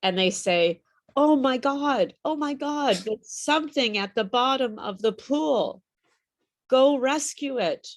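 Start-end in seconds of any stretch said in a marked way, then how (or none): 1.2-7.9 acted alarm. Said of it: "imagine someone saying this".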